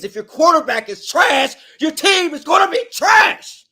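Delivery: real exasperated shout, last words slowed; angry outburst. Real, not acted. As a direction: blow up at the quarterback situation. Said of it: "I'm sorry for that outburst".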